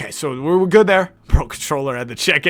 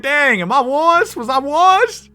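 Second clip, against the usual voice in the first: mock shocked; 12 semitones higher, 6 dB louder, slower than usual. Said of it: "I was like".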